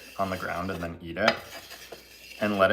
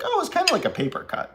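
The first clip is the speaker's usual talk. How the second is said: much higher, fast mocking squeak; mock silly voice; 4 dB louder, faster than usual. Mocking anyone calling a knife cut a paper cut, squeaky voice. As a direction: imitate someone brushing off a cut. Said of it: "you wouldn't be like".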